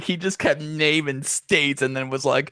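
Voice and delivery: ordinary delivery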